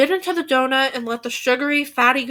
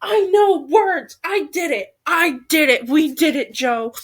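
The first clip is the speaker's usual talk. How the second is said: triumphant; 3 semitones higher, 3 dB louder than usual. Real, not acted. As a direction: crow over your small victory. Celebrating getting the line right.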